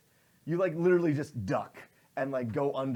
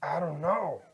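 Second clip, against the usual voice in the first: dim henchman; 3 semitones higher, 4 dB louder than usual. Acted. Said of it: "Shark turns around and goes".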